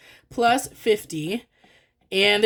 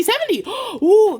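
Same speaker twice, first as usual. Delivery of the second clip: high, louder burst with a gasp; excited discovery; 12 semitones higher, 6 dB louder than usual. Real, not acted. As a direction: squeal it in real delighted surprise. Total matches the goal exactly.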